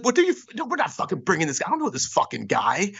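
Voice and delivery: high-pitched retold outburst of refusal; losing his mind. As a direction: imitate an indignant bandmate flatly refusing. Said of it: "Like, lost his mind".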